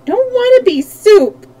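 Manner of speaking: put-on high, loud child's wail. A narrator's reading. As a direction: wail the refusal like a frightened small child. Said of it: "wailed Button-Bright again".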